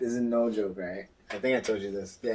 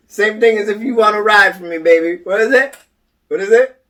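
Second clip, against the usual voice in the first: loud mock challenge; 10 semitones higher, 13 dB louder than usual. Pushing the ghost for an answer.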